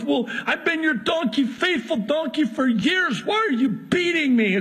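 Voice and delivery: high, pleading donkey voice; a faithful donkey asks why it is beaten; hurt talking donkey. Acted. Donkey complains to Balaam.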